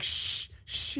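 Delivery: soft unvoiced shushing; shushing whisper. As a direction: hush them gently. Retold shushing someone.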